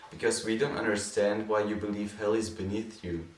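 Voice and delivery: ordinary delivery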